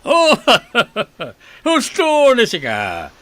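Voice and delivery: high, loud laugh into the words; pleased laugh. Acted. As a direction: chuckle proudly at the compliment. Boastful master laughs at flattery.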